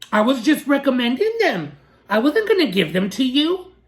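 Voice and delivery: mock his sarcastic excuse. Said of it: "He thinks he can be sarcastic to me".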